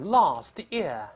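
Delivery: British "last year" with long ah, no r; British pronunciation. Shown as a demonstration. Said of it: "英国人读是".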